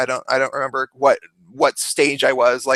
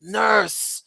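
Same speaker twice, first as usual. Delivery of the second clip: higher, whiny patient voice; patient pleading with the nurse for more pills; pained patient; 4 semitones higher, much slower than usual. Retold or imitated. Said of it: "he's like".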